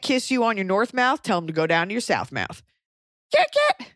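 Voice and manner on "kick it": whooped far higher, with a punchy finish; excited cheer. Real, not acted. Proud of her new term, cheering it high. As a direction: celebrate it like a catchphrase. Celebrating her coined phrase.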